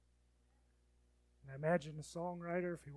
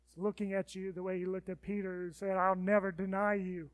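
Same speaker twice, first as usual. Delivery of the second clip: fiery pulpit delivery; preaching at full force; 3 semitones higher, 11 dB louder than usual. Acted. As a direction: preach it out to the congregation.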